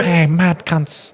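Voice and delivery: high, drawn-out attempt at an Aussie accent; imitated Australian accent. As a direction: mimic a Canadian mate's practised Aussie, slowly. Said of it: "trying to practice the Australian accent".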